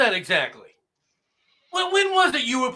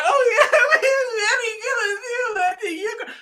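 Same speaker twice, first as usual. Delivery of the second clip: mock crying; 9 semitones higher, 3 dB louder than usual. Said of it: "these people are all crying".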